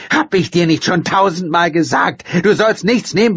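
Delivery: high, loud scolding yell; mother yelling at her daughter. Acted. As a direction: scold at full voice. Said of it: "brüllte sie".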